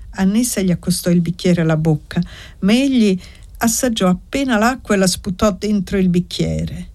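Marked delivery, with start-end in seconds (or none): none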